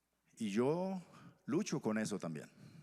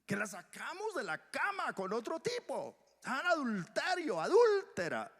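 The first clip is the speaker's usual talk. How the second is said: high, sneering voice of the angry crowd; mock the self-righteous accuser, high and jeering; scornful accuser; 13 semitones higher, 4 dB louder than usual. Preacher mimics the accusing crowd, high and scornful.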